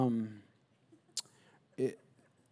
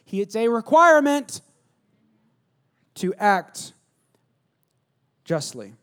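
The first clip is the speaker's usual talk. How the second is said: louder and higher, driving the point home; emphatic preaching; 5 semitones higher, 7 dB louder than usual. Real, not acted. Pastor insisting to the congregation, loud and emphatic. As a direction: hit it like a preacher pounding the pulpit.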